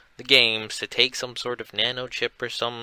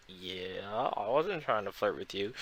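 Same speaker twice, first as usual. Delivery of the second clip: embarrassed quiet denial; 3 semitones lower, 11 dB quieter than usual. Retold or imitated. Mark denies flirting, embarrassed.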